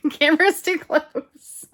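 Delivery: real laugh through slow, high, loud words. Real, not acted. Laughs at own camera mishap.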